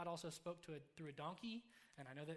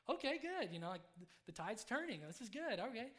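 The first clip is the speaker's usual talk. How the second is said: higher, louder upbeat burst; retelling an eager, relieved reaction; eager relief; 5 semitones higher, 6 dB louder than usual. Retold or imitated. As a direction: perk right up, pitch jumping. Relieved reply in retold story.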